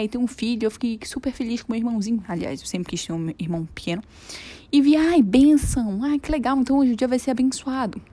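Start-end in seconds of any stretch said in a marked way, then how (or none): 4.7-8.0 retold delight. Retelling her joy at the dream.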